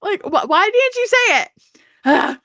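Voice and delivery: high, drawn-out mock exasperation; exasperated plea. Reader scolding Feyre for not saying it, exasperated. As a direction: plead with exaggerated, slow disbelief. Exasperated reaction to a story character.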